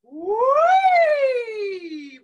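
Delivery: high, loud sliding whee; like a kid on a swing; joyful swing cry in head voice. Shown as a demonstration. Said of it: "Try that with me".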